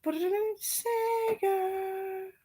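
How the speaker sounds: high called-out word from another room; imitated loud call. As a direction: call it out high like a kid from the bedroom. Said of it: "she wanted to be loud".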